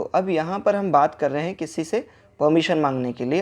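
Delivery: ordinary delivery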